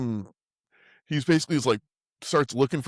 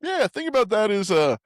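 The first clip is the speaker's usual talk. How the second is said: mock tough guy; 9 semitones higher than usual. Acting out Dalton's concussion speech.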